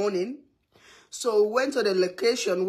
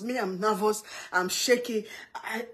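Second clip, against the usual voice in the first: ordinary talk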